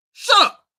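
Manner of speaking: high, loud snapped shut up; sharp shout. Real, not acted. Host yelling at the player to shut up. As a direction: cut him off hard.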